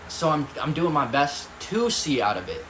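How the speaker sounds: ordinary delivery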